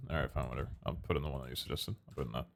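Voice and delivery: low, quiet, resigned grumble; grudging mutter. Real, not acted. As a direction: give in with a sulky mumble. Giving in grudgingly.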